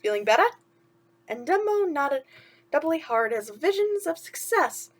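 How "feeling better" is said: chirpy sidekick asking what's wrong; a hint of cartoon mouse; lightly voiced mouse. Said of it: "Timothy asked".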